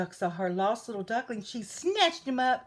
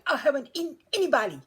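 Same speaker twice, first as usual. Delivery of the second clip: loud, fast, higher protest; howled protest; 5 semitones higher, 7 dB louder, faster than usual. A narrator's reading. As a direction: howl your innocence in a rush. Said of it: "he howled".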